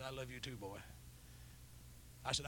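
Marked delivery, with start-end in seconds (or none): 0.0-0.8 choked up. Retold or imitated. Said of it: "you could tell he was crying".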